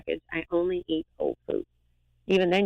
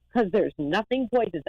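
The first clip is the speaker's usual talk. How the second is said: ordinary delivery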